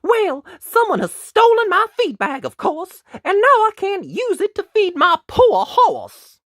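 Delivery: very high, loud knight in a huff; huffy knight. A narrator's reading. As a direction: complain indignantly as an affronted knight. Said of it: "in a bit of a huff".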